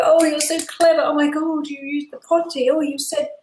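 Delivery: high, over-the-top delighted praise; a parent cheering the toddler's first potty use, mock gushing. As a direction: gush like a proud parent at a potty win. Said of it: "you go".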